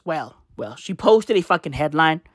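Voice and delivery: ordinary delivery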